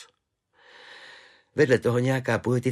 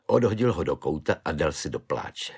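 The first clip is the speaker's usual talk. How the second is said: ordinary delivery, nothing marked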